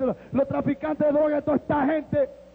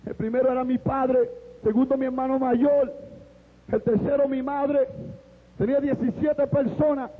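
ordinary talk, nothing marked

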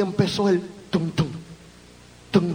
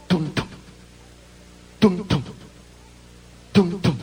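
low, soft heartbeat sounds; preacher imitating his own heartbeat; heartbeat thump; 7 semitones lower, 3 dB quieter than usual.

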